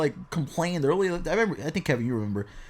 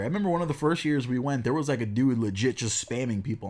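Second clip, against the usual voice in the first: ordinary talk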